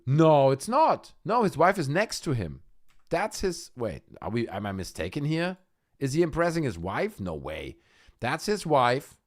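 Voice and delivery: usual speaking voice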